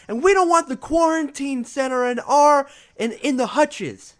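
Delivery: high, loud crowd heckle; angry shout. Acted. Red squirrel heckles the king, angry shout. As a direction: shout the complaint over the crowd. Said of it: "a lot of shouts".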